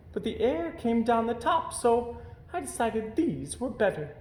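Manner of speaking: a camel pleased with her hump warmers; chatty camel. A narrator's reading. Camel voiced by narrator.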